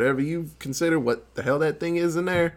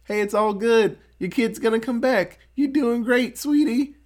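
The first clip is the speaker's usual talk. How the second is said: ghost-possessed sweet voice; 7 semitones higher than usual. Retold or imitated. Possessed handyman soothes the couple, creepy sweet. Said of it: "it's like".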